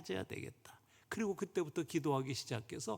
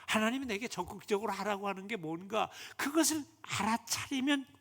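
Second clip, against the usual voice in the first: ordinary talk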